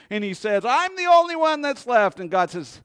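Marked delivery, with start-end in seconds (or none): 0.6-2.2 high, loud whining complaint; mock whine. Preacher mimics Elijah whining to God, high and loud. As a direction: whine it like a sulking child.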